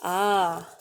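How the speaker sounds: ordinary delivery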